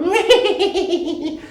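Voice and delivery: high, squeaky creature giggle; very high rippling little laugh; Baby Yoda giggle. Retold or imitated. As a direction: imitate a tiny creature tittering. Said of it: "see Baby Yoda go".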